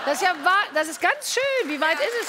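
high, delighted and loud; excited. Real, not acted. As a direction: gush with delight. Delighted at pregnant guest.

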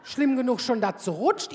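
ordinary delivery

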